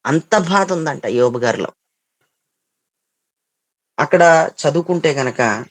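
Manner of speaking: ordinary delivery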